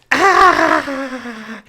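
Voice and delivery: high, loud hammed-up yelp; mock shriek of pain. Reviewer imitates the heroine stabbing herself, high mock yelp. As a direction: fake a jab of pain, overdone. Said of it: "She's like".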